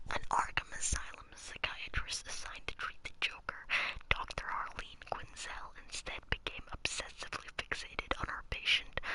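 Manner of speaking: whispered, unhurried ASMR narration; hushed, voiceless whisper; ASMR reading. Pretend. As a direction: whisper the bio softly into the mic. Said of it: "I'll read this one in A S M R".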